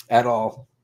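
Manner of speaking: ordinary delivery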